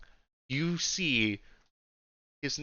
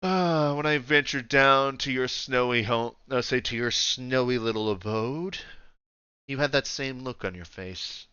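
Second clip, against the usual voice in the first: lightly voiced ruler, trailing softly; understated regal menace; a measured Emperor sizing up a subject; imposing Emperor; 3 dB quieter than usual. Game master plays the Emperor.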